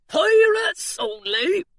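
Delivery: pirate guard announcing the rule. A narrator's reading. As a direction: drag out Pirates only like a decree. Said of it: "he said".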